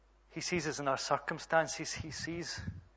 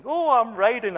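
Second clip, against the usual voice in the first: high, loud mock-child exclamation; proud kid's squeal, acted out; thrilled child; 7 semitones higher, 10 dB louder than usual. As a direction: imitate a little girl delighted to be riding. Voicing daughter thrilled on bike.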